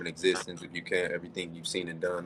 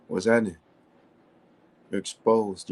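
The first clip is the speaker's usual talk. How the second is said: ordinary talk, nothing marked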